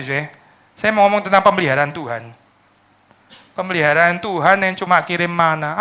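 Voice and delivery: ordinary talk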